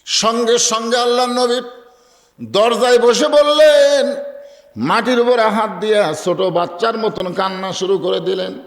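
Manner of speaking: high, ringing sermon climax easing slightly at the end; impassioned preaching. Acted. Preaches the climax at full pitch. (উঁচু গলায় সুর করে ওয়াজ)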